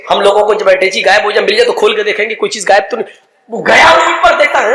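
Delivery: ordinary talk, nothing marked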